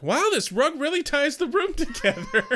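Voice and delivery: high, delighted voice; impressed decorator voice. Retold or imitated. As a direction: gush over the rug, voice up high. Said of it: "being like".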